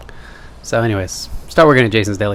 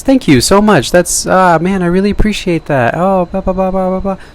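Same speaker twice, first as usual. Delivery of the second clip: higher, sugary people-pleaser voice; fake nice guy; 7 semitones higher than usual. Shown as a demonstration. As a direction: lay the charm on thick to win them over. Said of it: "if I talk more like this".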